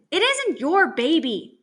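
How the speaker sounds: calling out. A narrator's reading. Brother corrects his little sister from his project, calling out. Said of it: "Jimmy calls".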